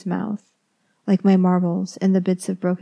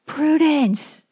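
yelling; 3 dB louder, slower than usual. A narrator's reading. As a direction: call her out in fury across the house. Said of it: "he yells".

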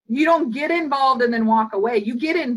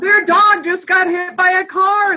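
high, loud exasperated cry; mock disbelief, voice shooting up; hammed-up outrage at the oblivious teen; incredulous; 8 semitones higher, 8 dB louder than usual.

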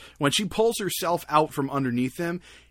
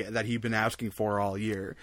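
ordinary delivery

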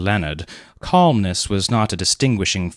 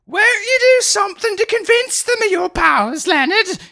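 put-on much higher pleading old lady voice; pleading aunt; 5 dB louder than usual. A narrator's reading. Aunt coaxing Leonard to show his powers, high pleading. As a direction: beg her nephew in a high fluttery aunt's voice. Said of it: "she pleaded".

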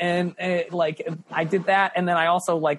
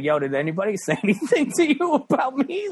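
high, anxious question with a laugh; mock-anxious check; nervous asking; 7 semitones higher than usual. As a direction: ask it worried and pitched up. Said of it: "I was like".

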